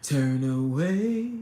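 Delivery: low, slow movie quote; movie line impression. Streamer quoting a favorite movie, low drawn-out imitation. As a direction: drop low and stretch the words. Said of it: "you know that part".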